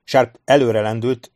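ordinary delivery

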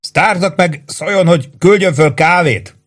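high, pressed shout over the gunfire. A narrator's reading. Sergeant shouting from the roof under fire. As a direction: yell it over the noise.

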